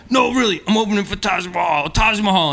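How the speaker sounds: high, thrilled rush of news; breathless giddy boasting, voice way up; excited bragging. Retold or imitated. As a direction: gush it to a friend on the phone. Excited calling up friends.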